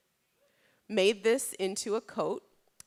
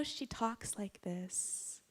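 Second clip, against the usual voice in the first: hushed, drawn-out near-whisper; slow, whispery impression of a gentle talker; slow and soft; 2 semitones higher, 12 dB quieter, much slower than usual. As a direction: imitate someone who talks slowly and softly. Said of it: "She talked really slow and softly".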